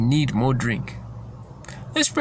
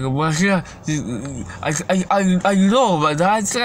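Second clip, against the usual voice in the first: put-on slurred, stammering drunk rambling, higher pitched; drunken babbling; 4 semitones higher than usual. Preacher mimicking how drinkers start talking, drunken babbling. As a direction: imitate a drunk losing the thread. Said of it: "They start talking like".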